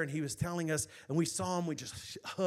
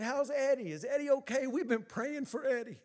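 put-on children's voices; 6 semitones higher than usual.

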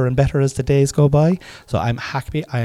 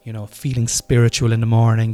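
ordinary talk, nothing marked

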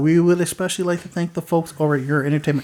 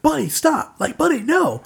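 high-pitched retold yell at a dog; imitated yelling; 12 semitones higher than usual. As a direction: call out in alarm, without full volume. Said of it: "let's just say she was yelling".

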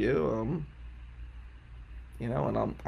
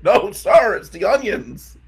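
flustered protest; 8 semitones higher, 10 dB louder, slower than usual. Retold or imitated. Defending himself, flustered.

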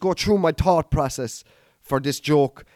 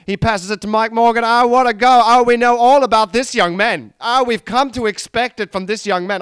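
excited commentary, higher and louder, building; mock football commentator; 5 semitones higher, 6 dB louder than usual. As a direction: call the match like a thrilled commentator. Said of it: "commentating on myself".

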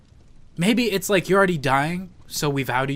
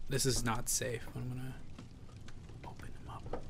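quiet hushed whisper; 3 semitones lower, 18 dB quieter than usual. Acted. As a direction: hush it down to a whisper.

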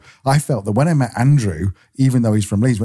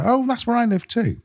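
much higher, lightly acted surprise; playful imitated surprise; mock surprise; 13 semitones higher than usual. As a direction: pop up high as if discovering a neighbour. Acting out a surprised neighbour.

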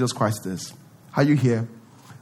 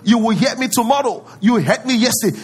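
confident prayer; 7 semitones higher, 3 dB louder than usual. Retold or imitated. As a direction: declare it with total confidence. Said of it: "See the confidence".

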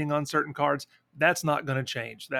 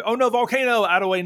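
excited; 8 semitones higher, 6 dB louder than usual. Real, not acted. Excited game reaction.

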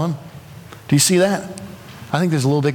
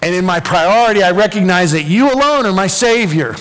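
rising, pleading prayer that climbs higher and louder; fervent prayer; 8 semitones higher, 4 dB louder than usual. Acted. Preacher voicing David's prayer, building to a fervent peak. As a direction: pour it out to God, building. Preacher praying Psalm with mounting intensity.

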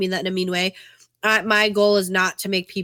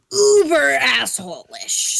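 slow self-mocking drawl; 4 semitones higher, 4 dB louder, much slower than usual. Real, not acted. Self-mocking confession, slowed.

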